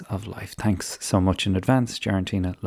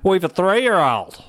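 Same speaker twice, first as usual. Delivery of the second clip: put-on Australian accent, pitched higher; hammed-up Australian accent; playful mock Australian accent; Aussie accent; 8 semitones higher, 3 dB louder than usual. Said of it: "I'm gonna be doing a lot more accents".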